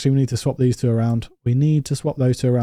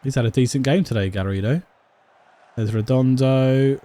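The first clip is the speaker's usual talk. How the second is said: ordinary talk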